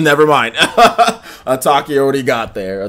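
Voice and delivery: talking through a laugh; real laughing. Real, not acted. Streamer finding moderator Ataki already acted, laughing. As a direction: break into a laugh mid-sentence.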